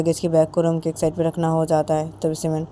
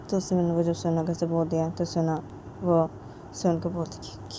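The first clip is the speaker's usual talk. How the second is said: usual speaking voice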